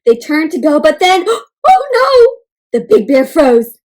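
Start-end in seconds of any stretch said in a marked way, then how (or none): none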